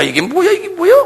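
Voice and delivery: high, put-on griping voice; mock griping voice. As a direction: whine it like a resentful church member. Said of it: "그러면서 하는 소리가".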